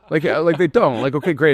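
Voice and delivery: chuckling through the words; laughing. Real, not acted. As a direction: laugh while you say it. Laughing at his own point.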